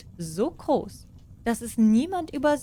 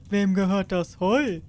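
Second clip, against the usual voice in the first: regal question, a touch louder; lightly voiced king; 3 dB louder than usual. King asks the peasants about the hay, a hint regal.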